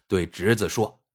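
plain reading voice

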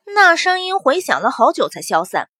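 usual speaking voice

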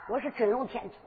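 ordinary delivery, nothing marked

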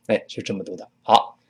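ordinary delivery

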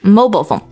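Mobile phone with Mobile as MOH-bul; American pronunciation. Shown as a demonstration. Host repeats after co-host, American pronunciation. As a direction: repeat Mobile phone with a reduced second vowel. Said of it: "但是美国人会说".

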